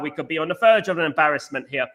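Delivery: usual speaking voice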